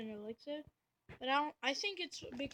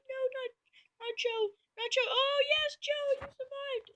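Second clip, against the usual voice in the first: much higher, louder cartoon voice, dread then relief; squeaky troop; 13 semitones higher, 7 dB louder than usual. A troop fears for Joe then cheers his survival, high and squeaky. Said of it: "He's like".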